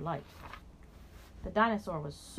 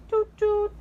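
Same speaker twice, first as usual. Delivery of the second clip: imitating boat horn; 14 semitones higher, 11 dB louder than usual. Danny and the dinosaur copy the boats, loud high toots. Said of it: "went Danny and the dinosaur".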